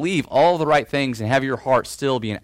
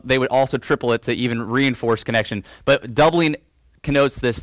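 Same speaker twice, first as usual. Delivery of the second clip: usual speaking voice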